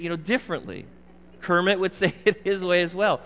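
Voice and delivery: ordinary talk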